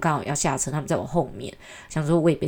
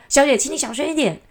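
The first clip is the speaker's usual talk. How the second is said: firmer, pitched up and louder; firm request; 9 semitones higher, 4 dB louder than usual. Retold or imitated. Passenger scolding the noisy girlfriend, firmer and louder. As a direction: tell her off a second time, sterner. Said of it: "那男生就再讲一次说".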